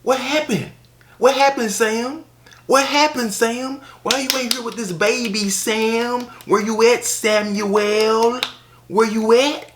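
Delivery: high, loud put-on voice calling out for a missing man; calling for Sam. Skit character calling Sam home to his baby, high shouting put-on voice. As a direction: holler for Sam like an exasperated mother left alone with his baby.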